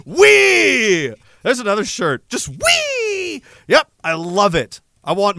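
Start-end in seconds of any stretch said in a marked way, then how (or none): 0.1-1.2 high, loud joyride cry; gleeful whee. Acted. Mimes gleeful ride down to destruction. 2.3-3.4 very high, drawn-out whee; gleeful squeal. Acted.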